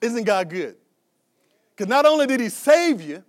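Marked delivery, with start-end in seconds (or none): 1.8-3.2 rising preaching. Acted. Preaching climax.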